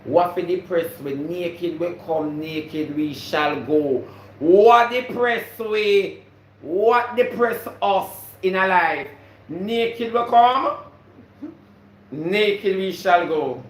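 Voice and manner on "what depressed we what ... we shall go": slow, loud, high preachy cadence; preaching. Real, not acted. Vlogger preaching slowly to her viewers. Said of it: "preaching proverb slowly".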